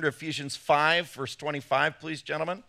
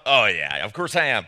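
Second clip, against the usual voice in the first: glib husband; 7 semitones lower, 3 dB louder than usual. Acted. Acting a husband's glib answer.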